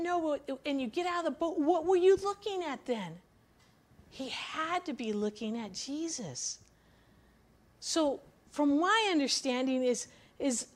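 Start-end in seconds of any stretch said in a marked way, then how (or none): none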